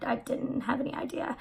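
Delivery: hushed, sheepish under the breath; guilty whisper. Retold or imitated. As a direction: mutter the excuse very softly. Caught by flight attendant.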